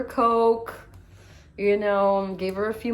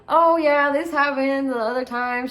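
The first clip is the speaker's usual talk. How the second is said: breezy friend; 6 semitones higher, 7 dB louder than usual. Retold or imitated. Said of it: "her friend's like".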